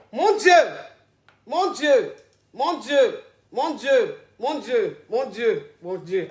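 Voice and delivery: dismayed, dying away; stunned lament. Real, not acted. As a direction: start aghast, fade down with each repeat. Host stunned by the revelation.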